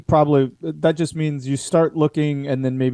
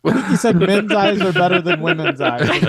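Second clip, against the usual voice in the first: laughing while talking; 10 semitones higher, 3 dB louder than usual. Real, not acted. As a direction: giggle through the line. Laughing at the joke.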